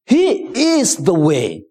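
he slowed right down before "the way"; stress on the way. Shown as a demonstration. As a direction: lean on the definite article in "is the way". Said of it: "Look at the definite article".

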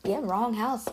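quiet, laughing let-down to the stranger; soft polite dismissal. Retold or imitated. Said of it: "I'm like".

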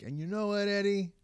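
high-pitched imitation of a woman; woman's comeback, imitated. Woman telling off her boyfriend, imitated high-pitched comeback. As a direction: put on a high female voice for the retort. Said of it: "her comeback is the greatest, though, bro, where she's like".

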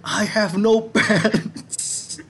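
high, slow, laughing mock yell; hammed-up yell through laughter; laughing cry of no pants. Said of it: "Terus teriak, teriak".

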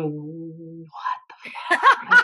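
slow, stretched, laughing disbelief; mock bafflement. American listener mocks confusion at English "water", slow and laughing. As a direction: drag it out, half laughing, lost. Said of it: "and we're going".

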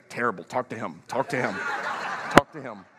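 low, quiet deadpan complaint; grumpy wife. Acted. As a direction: pass the question off sourly under your breath. Wife drops the church mask.

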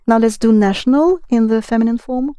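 usual speaking voice

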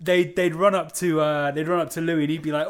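ordinary talk, nothing marked

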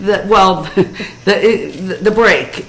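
breaking into laughter mid-word; stumbling on the words while laughing. Real, not acted. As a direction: giggle through it.

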